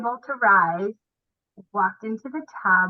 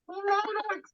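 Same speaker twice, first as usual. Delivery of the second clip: high, soft, delighted; 12 semitones higher, 7 dB quieter than usual. Retold or imitated. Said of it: "I'm like".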